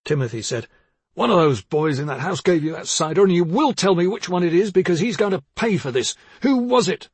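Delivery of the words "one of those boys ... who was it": furious threat. Acted. Father's furious demand.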